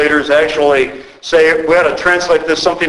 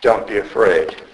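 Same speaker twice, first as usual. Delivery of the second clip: lower, softer, tender; gentle greeting; 9 semitones lower, 5 dB quieter than usual. Shown as a demonstration. Preacher voicing the risen Christ greeting believers, tender and soft. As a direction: say it like a gentle welcome. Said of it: "greets us on Easter morning with".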